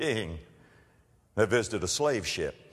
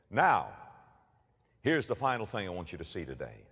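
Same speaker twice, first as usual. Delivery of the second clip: usual speaking voice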